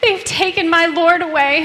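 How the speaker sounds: high, loud, desperate plea; anguished cry. Acted. Mary crying out to the angels about her Lord. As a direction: cry it out as if your Lord was stolen.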